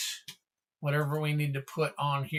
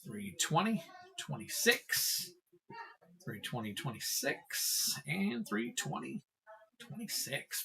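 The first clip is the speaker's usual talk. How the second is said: whispered counting; 11 dB quieter than usual. Real, not acted. Counting card numbers under breath.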